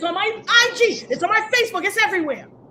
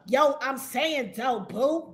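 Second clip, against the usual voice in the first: mocking imitation; 7 semitones lower, 7 dB quieter, slower than usual. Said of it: "Ain't nobody ever heard me sound like that".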